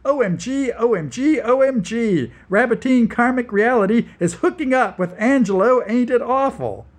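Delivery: high, loud gossipy squealing. Acted. Gossipy friends' mass message, squealing.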